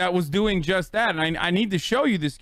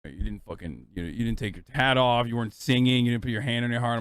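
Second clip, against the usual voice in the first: understated joking reproach, pitched down; jokingly scolding; 7 semitones lower, faster than usual. Retold or imitated. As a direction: imitate a friend's playful telling-off. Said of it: "jokingly scolding me".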